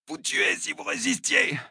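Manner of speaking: high, strained cry; agonized confession. Acted. Wounded attacker confessing to his torturer, high and agonized. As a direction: squeeze the answer out in pain.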